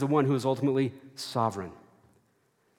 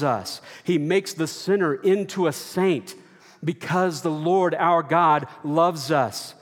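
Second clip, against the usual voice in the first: louder, climbing refrain; preaching at full force; 4 dB louder than usual. Acted. Preacher rousing the congregation with a rising, louder refrain. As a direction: build each line to a ringing climax.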